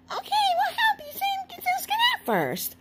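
very high, quick cartoon squeak; squeaky little character. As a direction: chirp it as a tiny helper friend. Voices friends agreeing to help.

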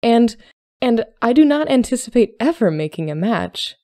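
high, emphatic protest with a stammered start; bursting out. A narrator's reading. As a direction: blurt it out, loud and flustered. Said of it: "she burst out".

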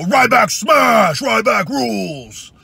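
slow, high, roaring catchphrase; wrestler shout. Retold or imitated. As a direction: bellow it from the turnbuckle. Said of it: "I just don't go".

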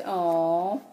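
ordinary talk

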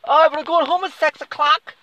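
mocking falsetto. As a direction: squeal it like a frightened kid. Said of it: "you're like".

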